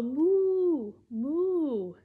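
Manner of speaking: drawn-out mooing cow. Pretend. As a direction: moo like a friendly cow. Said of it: "He can go like a cow".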